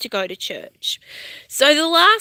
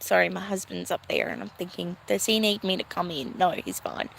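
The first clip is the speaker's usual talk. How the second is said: quick, softer aside so the husband won't hear; hushed aside; 4 semitones lower, 7 dB quieter, faster than usual. Real, not acted. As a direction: drop the voice to a near-whisper, checking on the husband. Husband nearby, lowered voice aside.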